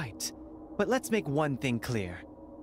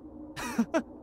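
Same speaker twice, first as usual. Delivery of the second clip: short breathy scornful laugh; 9 semitones higher than usual. Acted. Villain laughing at the guard, scornful laugh.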